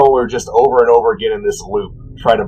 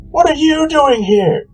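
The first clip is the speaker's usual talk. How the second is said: surprised god; slower than usual. Acted. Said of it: "Sirik points at the Solar and goes".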